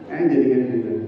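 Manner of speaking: ordinary talk, nothing marked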